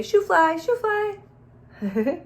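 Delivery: high sing-song shooing; playful high-pitched shoo; shoo fly. Pretend. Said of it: "Sometimes I just say".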